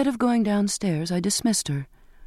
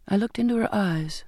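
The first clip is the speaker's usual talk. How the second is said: ordinary delivery, nothing marked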